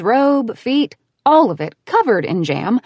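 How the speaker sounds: ordinary delivery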